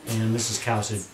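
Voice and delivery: usual speaking voice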